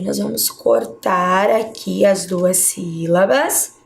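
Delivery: usual speaking voice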